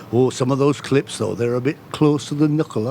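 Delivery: low-pitched mock Carlisle voice; Carlisle accent. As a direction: imitate a prim Carlisle interviewer. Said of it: "slipped into my Carlisle accent".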